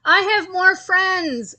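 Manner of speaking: high, loud and slow boast; loud slow bragging cry; cried out. A narrator's reading. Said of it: "cried another".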